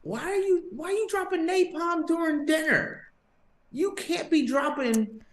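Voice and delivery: very high, incredulous telling-off; mock scolding. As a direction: scold him in comic disbelief. Mock scolding the man in the story.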